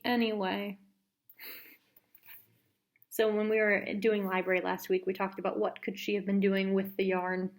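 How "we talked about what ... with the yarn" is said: usual speaking voice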